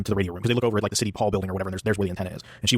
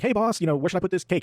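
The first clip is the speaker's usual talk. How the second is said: higher-pitched retold call to the boss; bakery worker calling out; 8 semitones higher than usual. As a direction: call over to the boss, lightly acted.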